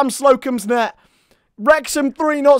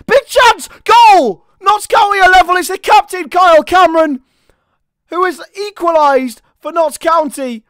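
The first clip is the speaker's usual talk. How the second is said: shouting the goal call, much higher and louder; goal celebration; 6 semitones higher, 7 dB louder than usual. Real, not acted. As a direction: erupt as the equaliser goes in. Goal for Notts County.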